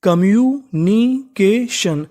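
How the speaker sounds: Communication stretched out slowly, syllable by syllable; teacher drawing out communication at a crawl; slow spelled-out demo. Shown as a demonstration. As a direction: model communication very slowly for learners. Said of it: "तो meaning बनी".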